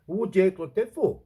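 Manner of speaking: high, loud, sneering mimic of critics; exaggerated nagging accusation; mocking accusatory quote. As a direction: throw it back at them in a scornful put-on voice. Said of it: "They go".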